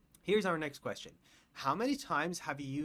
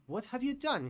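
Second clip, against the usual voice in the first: surprised question; 10 semitones higher, 4 dB louder than usual. Pretend. Asking a breathless friend.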